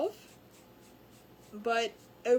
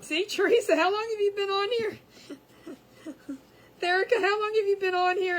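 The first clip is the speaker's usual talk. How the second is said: high, loud, laughing through the words; 7 semitones higher, 7 dB louder than usual. Real, not acted. To the viewer she misnamed, laughing loudly. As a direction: giggle helplessly at own mistake. Laughing at her own name mix-up.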